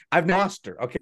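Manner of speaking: ordinary talk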